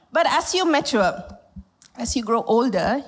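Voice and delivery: ordinary talk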